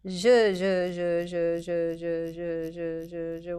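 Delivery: quick zh demo trailing off; fading fast zh sounds. Shown as a demonstration. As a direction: let the buzzing rhythm run down. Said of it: "when you're faster".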